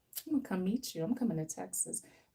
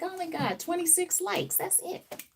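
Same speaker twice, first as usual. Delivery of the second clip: high, mock-dismissive voice, way up in pitch; mocking unimpressed viewer; 11 semitones higher than usual. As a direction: sneer it like a bored viewer. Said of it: "They don't be like".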